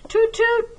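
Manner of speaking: very high tooting like a boat horn. A narrator's reading. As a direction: imitate a boat whistle. Boats hooting in story.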